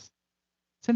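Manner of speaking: usual speaking voice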